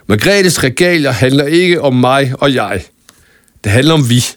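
a touch of a rallying cry; mild shout. Acted. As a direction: declare it for everyone to hear. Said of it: "råbte Lærke".

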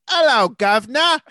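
loud, high, hammed-up fake Cockney; botched American Cockney. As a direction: belt out a terrible Cockney greeting. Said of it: "botched American Cockney".

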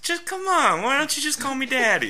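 very high whiny coaxing; mock wheedling. Acting a partner pressing for the name, high wheedling whine. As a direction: nag in a hammed-up whine.

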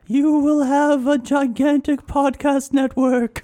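slow, high, eerie prophecy; slow spooky ghost voice. Pretend. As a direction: intone it like a ghost. Playing Bloody Mary prophecy.

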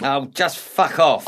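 loud, slow, exasperated swearing; angry dismissal. Acted. Younger man ending the row, angry. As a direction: spit it out, completely fed up. Quarrel peaks.

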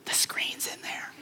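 secretive whisper. Retold or imitated. Wife confesses secret in closet.

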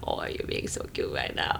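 soft whispered praise; tender whisper to self. Retold or imitated. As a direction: whisper it to yourself lovingly. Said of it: "and I'm talking to myself, and I'm like".

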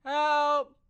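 high, loud cry for help; a lost kid calling out "Help!" to the woods; kid's shout for help. Acted. Lost camper calling for help, high and loud.

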